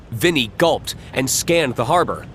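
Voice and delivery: usual speaking voice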